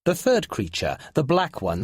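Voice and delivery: usual speaking voice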